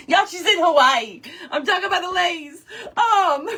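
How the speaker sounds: talking through giggles; can barely get the words out for laughing. Real, not acted. Teasing a viewer in Hawaii, laughing through it. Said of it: "I can hear her giggling".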